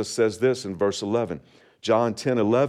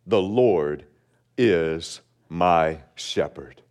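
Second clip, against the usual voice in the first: slow, weighty reading of the verse; slow solemn reading; 5 semitones lower, much slower than usual. Shown as a demonstration. Pastor reading the psalm aloud to the congregation, slow and solemn. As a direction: let each word sink in slowly. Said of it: "simply starts off this way".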